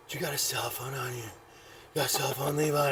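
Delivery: put-on low, hushed drunken mumble. Drunk man asking his seatmate for a phone, low and mumbled. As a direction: murmur it like a wasted seatmate.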